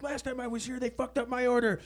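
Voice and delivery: high, snotty voice rising to loud; bitchy customer. Retold or imitated. Bitchy friend complaining at the drive-thru, high and snippy.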